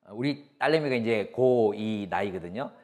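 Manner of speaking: ordinary delivery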